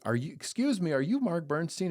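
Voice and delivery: nervous-thrilled fan approaching a star; excited fan question. Retold or imitated. Wife eagerly stopping the celebrity lawyer, high excited. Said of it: "And she's like".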